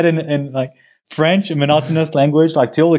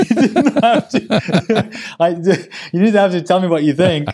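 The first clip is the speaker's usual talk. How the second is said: laughing; 5 semitones higher, slower than usual. Real, not acted. Teased about his very bad German.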